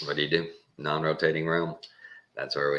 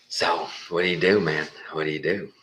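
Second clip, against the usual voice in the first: usual speaking voice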